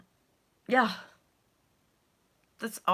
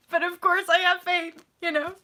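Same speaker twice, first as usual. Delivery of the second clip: high, tearful faith; 8 semitones higher, 3 dB louder than usual. Real, not acted. Telling viewers she still has faith, crying. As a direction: cry out hope through tears. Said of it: "and I'm kinda scared".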